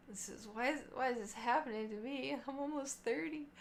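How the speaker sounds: small, put-upon voice; mock bewildered complaint. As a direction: complain quietly in disbelief. Acts out own bewildered complaint.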